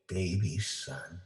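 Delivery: plain reading voice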